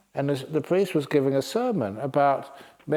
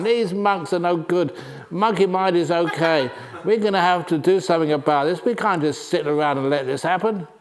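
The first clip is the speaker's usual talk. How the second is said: a riled-up crowd of monkeys; agitated monkey troop; 4 semitones higher than usual. Acted. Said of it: "they started jumping up and down".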